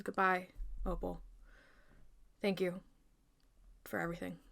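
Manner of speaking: soft, sorrowful farewell; heavy-hearted goodbye. Acted.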